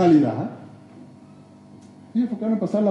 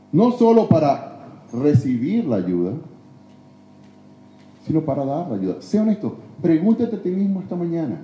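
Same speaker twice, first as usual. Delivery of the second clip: usual speaking voice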